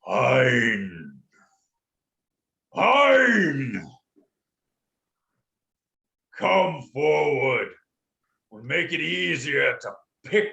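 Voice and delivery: slow, imperious dragon with booming openings; large dragon voice. Acted. The great dragon summons the grovelling visitors forward, slow and booming. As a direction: boom the first words, then slow and commanding. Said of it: "the large voice says".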